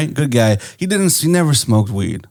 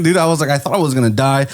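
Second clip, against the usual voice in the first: ordinary delivery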